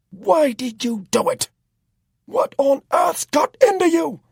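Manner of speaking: a very high, loud, slow roar. A narrator's reading. Jack roars at Tom demanding why he did it, enraged. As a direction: bellow it at him in a rage. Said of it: "he roared".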